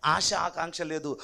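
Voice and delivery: ordinary delivery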